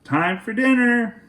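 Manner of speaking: high call from the porch; dad calling across the yard. Acted. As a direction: call them in for dinner. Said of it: "he called".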